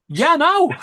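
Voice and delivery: high, loud Lancashire burst breaking into laughter; Lancashire accent. Retold or imitated. Man turning his accent back on for Essex stranger, exaggerated Lancashire. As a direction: put the Lancashire on thick and high. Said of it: "I turned back on the Lancashire accent".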